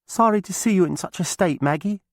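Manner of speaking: put-on young witch girl, sorry for her; Alice, sympathetic girl. A narrator's reading. Alice greets chained Maggie, high and sorry.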